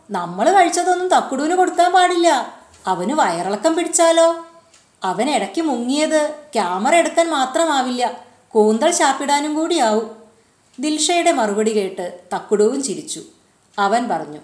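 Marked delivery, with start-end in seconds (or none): 0.1-10.2 put-on lightly raised girl's voice, worried then teasing; a higher, lively young girl; Dilsha's voice. A narrator's reading. (ഉയർന്ന സ്വരത്തിൽ കുട്ടിയുടെ ശബ്ദം)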